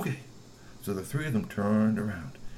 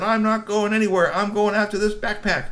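high, loud refusal; defiant; 10 semitones higher, 6 dB louder than usual. Acted. Globilda refuses to let go, high and defiant. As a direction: stand your ground.

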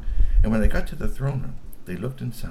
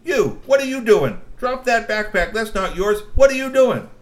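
high, loud scolding shout; angry yell; 11 semitones higher, 9 dB louder than usual. Acted. Troll girl yelling at Grassy, loud and high. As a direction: yell at the thief to drop it. Globilda yells at thief.

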